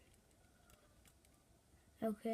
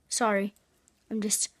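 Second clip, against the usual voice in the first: ordinary delivery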